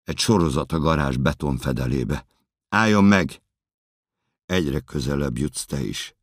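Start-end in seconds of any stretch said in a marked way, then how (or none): 2.7-3.4 high, loud command to halt; shouted warning. Acted. Officers ordering the runner to stop, shouted warning. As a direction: yell it as an order. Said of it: "kiabálnak".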